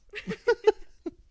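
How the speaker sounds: high burst of laughter. Real, not acted. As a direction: break into a squealing laugh. Laughs at own story.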